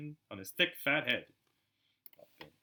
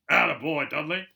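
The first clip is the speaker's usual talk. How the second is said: loud, higher booming proud father; loud proud Uncle Vernon cheering his son; 4 semitones higher, 12 dB louder than usual. Acted. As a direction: bellow it like a doting blustery uncle.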